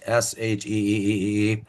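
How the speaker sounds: she, the E held long; drawn-out she. Shown as a demonstration. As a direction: stretch the E in she. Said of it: "the sound is she".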